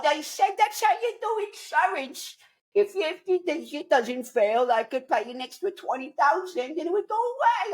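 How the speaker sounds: mock wimpy rich guy making excuses; wimpy hedge fund guy. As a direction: whine out the excuses.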